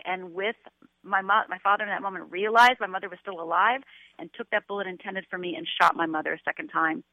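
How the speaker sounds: ordinary talk, nothing marked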